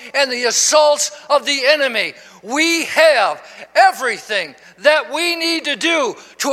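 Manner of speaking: emphatic, measured sermon delivery; preaching. Acted. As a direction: build to slow, ringing declarations of victory. Preaches to congregation.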